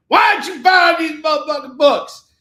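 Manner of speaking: high, loud hammed-up scolding shout; mock Samuel L. Jackson yell. Host voicing the actor's poster at viewers, mock yell. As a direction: bark it like an angry movie tough guy. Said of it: "and he's like".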